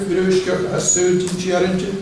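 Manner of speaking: ordinary talk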